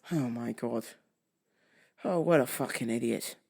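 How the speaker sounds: low, soft, weary muttering; exasperated aside. Acted. Victim on officer's doubting question, exasperated low mutter. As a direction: drop the voice way down in disbelief.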